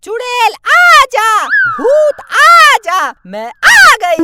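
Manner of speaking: hammed-up witch mocking the summons; stretched loud witch voice. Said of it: "अब acting मत कर".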